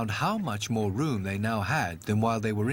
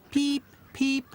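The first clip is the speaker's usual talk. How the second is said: high squeaky chick peeps; ducklings peeping; 14 semitones higher than usual. A narrator's reading. Newborn ducklings cry out from their eggs, high peeps.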